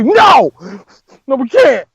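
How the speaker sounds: loud, high shouted refusal; mock outburst. Vegeta refusing Bulma's plea, hammed-up shout. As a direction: blurt it out in exaggerated protest. Acted refusal in bedroom quarrel.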